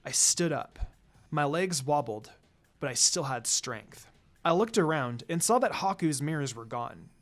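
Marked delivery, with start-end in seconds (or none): none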